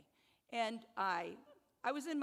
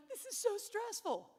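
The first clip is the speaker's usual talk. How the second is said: mock stressed whine; 11 semitones higher, 4 dB louder than usual. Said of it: "And you're like".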